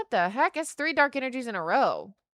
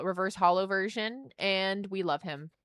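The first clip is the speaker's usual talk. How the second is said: ordinary talk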